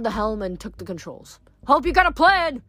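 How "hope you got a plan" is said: high, loud shout over the fight. A narrator's reading. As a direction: yell it from the helm. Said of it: "he shouted".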